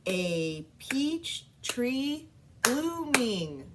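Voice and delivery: very slow, "A peach tree blooming" syllable by syllable; syllables clapped. Shown as a demonstration. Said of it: "I'm gonna clap so that you can hear the different syllables".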